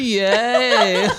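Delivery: high loud fake yay; mock cheer. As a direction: cheer it with a laugh.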